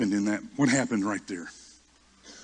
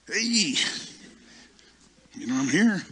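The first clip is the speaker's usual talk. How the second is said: high-pitched, drawn "hey" to get noticed; angling for notice; 7 semitones higher, 3 dB louder than usual. Retold or imitated. Preacher replays himself hovering beside the superintendent, angling for notice. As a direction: pipe up high, hoping to be seen.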